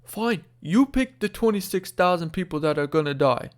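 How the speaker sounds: bad New York accent. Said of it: "that's the worst New York accent you've ever heard".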